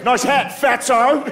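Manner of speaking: high, mocking Australian heckle; Australian goth. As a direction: toss off the insult in a put-on Australian accent. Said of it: "he's a goth from Australia".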